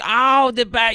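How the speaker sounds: high, loud mock "oh"; imitating a prankster's put-on voice; cheesy obnoxious voice. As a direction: blare it out like an obnoxious customer. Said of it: "this obnoxious voice".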